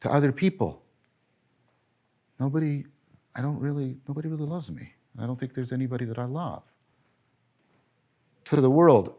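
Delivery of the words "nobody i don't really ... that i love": softly voiced lonely regret; quiet confession. Lecturer voicing a dying person who faces an empty life, quiet regret. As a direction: murmur it like a deathbed admission.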